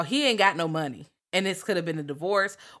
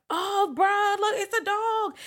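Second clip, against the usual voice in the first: put-on much higher, a kid's urgent call; a little boy trying to get adults' attention; boy alarmed; slower than usual. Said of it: "Santino is like".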